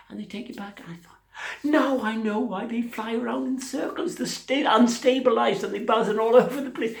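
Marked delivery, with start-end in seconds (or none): 1.6-7.0 high, loud, excited discovery; high-pitched comic eureka; mock realisation. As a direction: blurt it out like a daft revelation.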